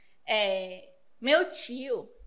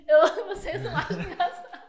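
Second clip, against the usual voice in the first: laughing; 6 semitones higher, 6 dB louder than usual. Real, not acted. Laughs at own joke.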